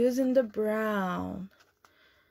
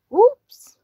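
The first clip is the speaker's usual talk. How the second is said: surprised oops; 12 semitones higher, 12 dB louder than usual. Acted. Animals blunder into wrong store.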